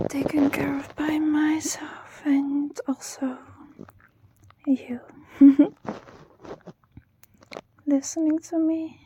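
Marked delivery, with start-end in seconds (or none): 5.4-6.0 whispered giggle. Real, not acted. Whispered laugh mid-sentence.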